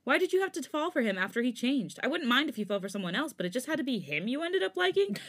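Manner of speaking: a furious demand. Acted. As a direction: throw the accusation at him. Changbin furious argument.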